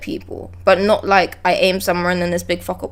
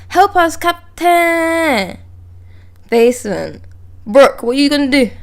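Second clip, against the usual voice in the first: slow, drawn-out cry for help; dramatic plea; 5 semitones higher, slower than usual. Acted. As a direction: stretch it out, begging the captain. Reading a desperate game line.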